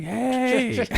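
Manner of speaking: high indignant hey; aunt's protest. Retold or imitated. Aunt Norma to teasing family, high indignant yelp. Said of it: "And she's like".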